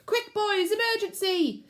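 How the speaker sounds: very high, loud alarm call; shouted alarm. A narrator's reading. Pack leader warning his scouts, shouting. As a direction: sound the alarm to the boys. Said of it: "The pack leader shouted".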